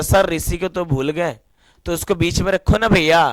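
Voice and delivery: ordinary delivery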